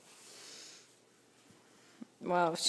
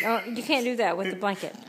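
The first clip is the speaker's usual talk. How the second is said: usual speaking voice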